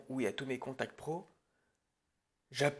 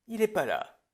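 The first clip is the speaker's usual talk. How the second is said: a sudden high, loud blow-up; he's not here, exasperated cry; exasperated outburst; 9 semitones higher, 9 dB louder than usual. Acted. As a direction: throw up your hands and yell it. Director exasperated by absent crew.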